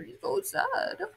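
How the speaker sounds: high, slow soft sympathetic coo; mock pity. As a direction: pity the little fish aloud. Pitying crying fish picture.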